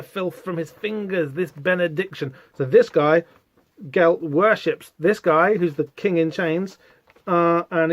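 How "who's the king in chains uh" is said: normal talking voice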